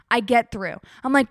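ordinary delivery

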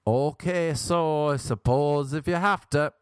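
high, drawn-out giving in; reluctant politeness. Acted. Too polite to say no to a guest, reluctant high consent. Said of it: "I need to be less polite".

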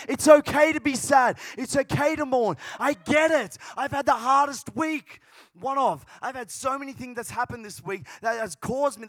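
high, driving preacher voice building to loud; full-force preaching. Real, not acted. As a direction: press it on the crowd, swelling to a shout.

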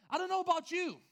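high, loud exclamation of disgust; emphatic outburst. Real, not acted. Preacher protesting the poopy house to the crowd, loud outburst. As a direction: cry it out loudly to the crowd.

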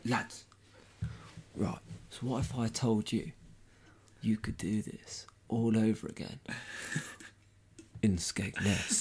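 soft, whispered sales pitch; hushed pitch. Retold or imitated. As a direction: lean in and tempt them quietly. Con man pitching a secret deal.

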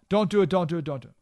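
mock nervous pleading, fading off; fearful advisors. Advisors begging him not to invade, mock nervous pleading. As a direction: repeat it nervously, then trail away. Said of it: "They were fearful, again, emotional".

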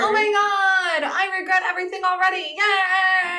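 high, hammed-up dread then cheer; mock dread. Mock horror at the questions segment, then a singing yay.

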